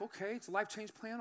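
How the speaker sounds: soft, noncommittal reply; retold hesitant agreement, softly spoken; tentative acceptance. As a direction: go along with it quietly.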